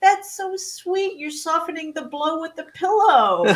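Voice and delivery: high, drawn-out mock-sweet teasing; laughing husband. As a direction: imitate him cooing through amusement. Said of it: "that's making him laugh even harder".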